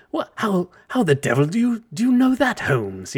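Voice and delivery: hammed-up British sidekick, rising high; mock Watson. Mocking a sidekick's baffled line about Holmes. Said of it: "that kind of, uh, acting".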